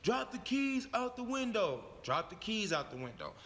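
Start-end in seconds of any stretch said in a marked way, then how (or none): 0.0-1.8 raised-pitch officer's order; police officer command. Acted. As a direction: instruct the driver like police at a stop. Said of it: "one of the officers said".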